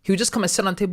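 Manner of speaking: ordinary delivery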